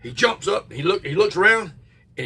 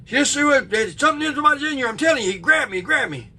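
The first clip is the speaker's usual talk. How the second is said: higher, louder frightened pleading; scared kid; 9 semitones higher, 4 dB louder than usual. Retold or imitated. Frightened boy insists to his father someone grabbed him, higher pleading. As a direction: insist like a terrified twelve-year-old.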